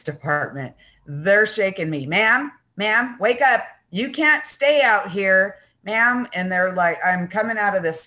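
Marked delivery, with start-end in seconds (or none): 2.1-6.3 louder, higher officer calling her to wake; police officer waking her. Retold or imitated. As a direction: firmly rouse a sleeping woman, ma'am.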